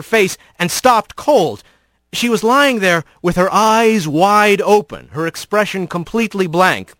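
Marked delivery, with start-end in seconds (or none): none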